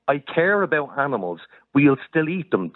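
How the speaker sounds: mock paraphrase of her view; sarcastic imitation of her; mocking quote. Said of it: "you're saying".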